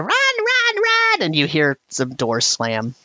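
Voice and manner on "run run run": very high panicked goblin shouts; fleeing goblin. Acted. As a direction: goblins shrieking as they run away. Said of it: "you hear them down there".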